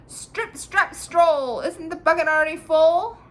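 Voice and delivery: loud, high call from inside the cow; crying out. A narrator's reading. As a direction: shout it up so the milker hears. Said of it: "Tom Thumb cried out".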